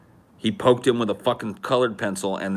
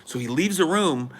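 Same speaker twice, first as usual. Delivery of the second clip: ordinary talk, nothing marked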